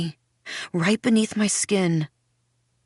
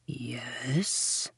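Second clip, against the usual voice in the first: very soft yes; softly; 6 semitones lower, 11 dB quieter than usual. A narrator's reading. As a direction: say it softly, almost gone. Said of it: "the goblin says softly".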